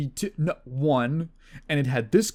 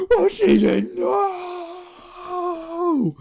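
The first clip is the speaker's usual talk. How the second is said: much higher, louder mock wail of despair; imitating an anguished cry; 6 dB louder, much slower than usual. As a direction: wail like a man watching his world end. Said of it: "where he's like".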